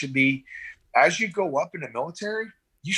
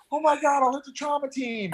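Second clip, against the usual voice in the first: much higher, hammed-up panic; mock panicked nurse; 13 semitones higher than usual. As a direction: gasp like a starstruck nurse. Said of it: "You're like".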